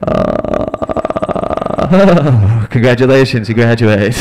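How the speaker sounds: a touch of a pleased examiner, laughing through it; the exam graders praising the student, lightly mocking and laughing. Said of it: "This is what the exam sounds like".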